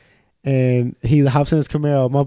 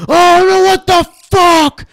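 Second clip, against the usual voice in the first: very high, loud, drawn-out howl; hammed-up distraught shouting; anguished mock scream; 10 dB louder, slower than usual. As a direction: yell like your car was wrecked. Retold owner seeing damaged car.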